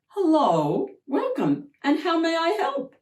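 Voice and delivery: high, nervous little welcome; small yelp. Acted. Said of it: "his voice a small yelp".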